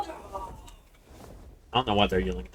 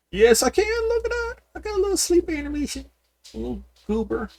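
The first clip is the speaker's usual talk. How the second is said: much higher, squeaky cooing voice; cutesy baby talk; 4 dB louder than usual. Pretend. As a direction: coo at your little pet like a baby. Cooing at her cute game dragon.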